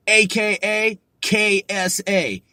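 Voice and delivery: ordinary talk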